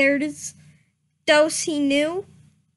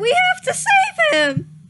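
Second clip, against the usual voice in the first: much higher, louder urgent plea; frantic rescue cry, half laughing; desperate yell; 5 dB louder than usual. A narrator's reading. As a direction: yell it while breaking into laughter. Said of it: "yelled Starkit".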